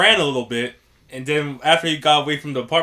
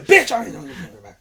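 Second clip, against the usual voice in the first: fast, higher sassy retort; dog thief mocking reply; 9 semitones higher, 4 dB louder, much faster than usual. Dog thief talking back to the girlfriend, fast and sassy. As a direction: brush her off quickly.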